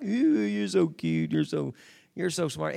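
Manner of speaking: lightly mocked gushing over a little child; silly baby talk. Adult talking stupid to little kids, mild baby talk. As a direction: coo a bit stupidly, as if to a baby. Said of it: "you just talk to them stupid like".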